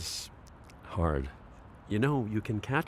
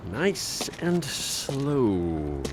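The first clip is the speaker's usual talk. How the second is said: slow; much slower than usual. Acted. Easing wheelchair down a ramp.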